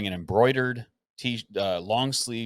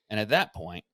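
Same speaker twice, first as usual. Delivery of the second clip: ordinary talk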